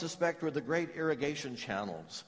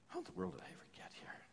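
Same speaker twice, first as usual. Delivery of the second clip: hushed despair; 4 semitones higher, 17 dB quieter, much faster than usual. Retold or imitated. Exile's despairing question, hushed.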